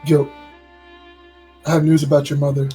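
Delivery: usual speaking voice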